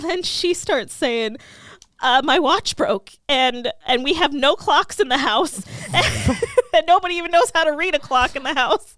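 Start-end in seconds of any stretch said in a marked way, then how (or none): 2.0-9.0 higher, louder flustered excuse-making; Buffy. Retold or imitated. Buffy excusing her absence to Owen, flustered. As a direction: babble feeble excuses, amused underneath.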